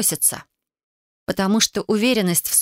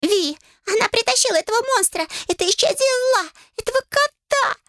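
extremely high, squealing creature voice; screaming owl familiar. A narrator's reading. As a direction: shriek an outraged accusation. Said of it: "вновь заорала белка".

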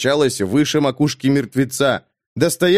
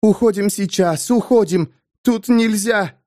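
higher, urgent frightened pleading; a scared woman begging them all to leave; frightened muttering; 8 semitones higher than usual. A narrator's reading. Gwenda begging the soldiers to leave, frightened and higher. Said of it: "бормотала".

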